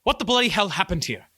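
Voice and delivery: angry guard chief. Acted. Guard chief storming onto the casino scene, angry demand. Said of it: "struts forth in anger".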